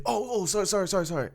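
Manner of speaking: imitated high-pitched apologising; flustered apology. Imitates man apologising after bump.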